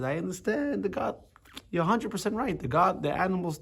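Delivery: high, coaxing voice agreeing with the animal soul; godly soul's voice. Acted.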